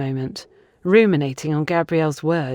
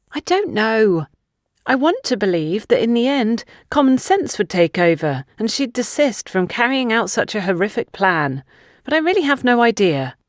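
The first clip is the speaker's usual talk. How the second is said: put-on higher, hesitant young woman; unsure, letting her doubt show; uncertain young woman; 6 semitones higher than usual. Funke admitting she cannot vouch for Antonia, uncertain.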